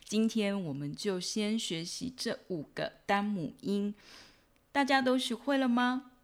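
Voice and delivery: ordinary talk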